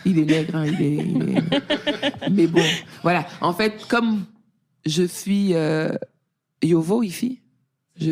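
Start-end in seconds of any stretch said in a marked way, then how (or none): 0.1-2.3 words broken up by real laughter; laughing. Real, not acted. Teller to friends about the doctor, laughing helplessly. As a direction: laugh through it, barely getting the words out.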